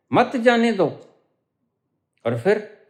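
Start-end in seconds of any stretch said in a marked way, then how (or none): 0.1-0.9 "मत जाने दो" as one high-pitched phrase; मत joined to जाने दो. Shown as a demonstration. Teacher demonstrating the forbidding sense, मत जाने दो kept together. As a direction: start high on मत and run on through जाने दो. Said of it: "सिर्फ ठहराव बदल दो".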